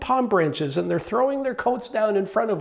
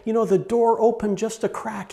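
normal talking voice

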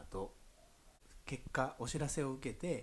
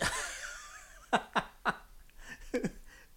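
long high giggling; high laugh; 14 semitones higher than usual. Real, not acted. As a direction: laugh at the coincidence. Laughs at the drawn card.

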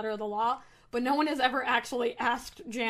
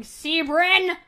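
loud, high call of his name; calling out sharply; 5 semitones higher, 9 dB louder than usual. Acted. Priestess calling out to the boy, loud and high. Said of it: "raising her voice octave by octave".

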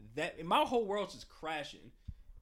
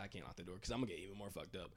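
muttered; 8 semitones lower, 12 dB quieter, faster than usual. Retold or imitated. Resigned inner thought.

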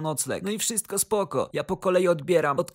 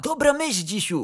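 bright jump up in pitch; eager agreement; 6 semitones higher than usual. A narrator's reading. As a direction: seize on the idea keenly. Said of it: "podchwycił ochoczo Grzegorz Lato". (ochocze, wysokie przytaknięcie)

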